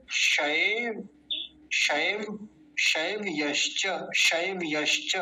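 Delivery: शैव, then शैव यश्च, slow with a clear y; correct slow demo. Shown as a demonstration. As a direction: model शैव यश्च in two careful pieces. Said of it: "वैसा नहीं करेंगे". (शैव यश्च धीरे, य साफ़)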